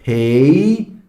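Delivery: ordinary delivery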